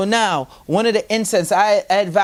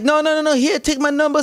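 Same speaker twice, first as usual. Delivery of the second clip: eager insisting; 8 semitones higher, faster than usual. Retold or imitated. Insisting to cab driver after crash.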